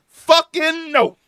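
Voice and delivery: pissed-off outburst. Real, not acted. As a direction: explode at the show in real anger. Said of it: "I'm losing my fucking voice because I'm so pissed off".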